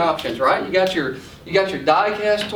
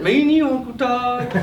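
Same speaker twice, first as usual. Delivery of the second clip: put-on coaxing little boy; 6 semitones higher than usual. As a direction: sweet-talk your uncle into a toy. Nephew angling for a toy.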